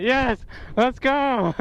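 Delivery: high victorious shout; triumphant cheer. Real, not acted.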